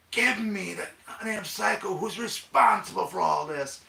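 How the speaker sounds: high-pitched, drawn-out furious demand; ranting mayor. Acted. Mayor demanding the culprit at police station, wild rant. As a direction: rage like a mayor gone wild. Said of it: "the mayor's just going ape shit, just going wild, going crazy".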